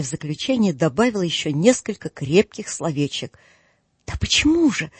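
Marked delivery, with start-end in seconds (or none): 4.1-4.9 high, astonished cry; a shocked high cry of why; exclaimed. A narrator's reading. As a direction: cry out in disbelief. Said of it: "вскричал племянник".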